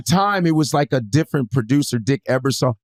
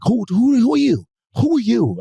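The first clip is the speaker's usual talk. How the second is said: imitating the blonde lady; 10 semitones higher than usual. Lady casting agent demanding to know who he is. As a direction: squeak out the demand like a nosy lady. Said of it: "she's like".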